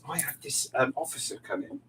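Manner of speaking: ordinary delivery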